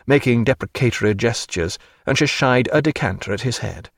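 ordinary delivery, nothing marked

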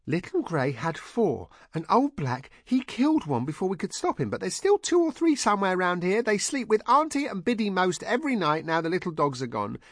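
high-pitched eager chatter; artless, high-voiced running explanation; excitable Heather voice. A narrator's reading. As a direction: rattle it off way up high. Heather eagerly explains about kittens.

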